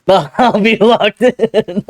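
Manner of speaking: laughing through the words; high, loud, slow laughing delivery. Real, not acted. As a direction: crack up while saying it. Laughing at own joke.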